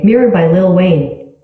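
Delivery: normal talking voice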